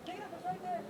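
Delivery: high, hushed coaxing; coaxing dog voice. Real, not acted. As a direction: sing it softly up high to lure the dog. Coaxing dog to find bone.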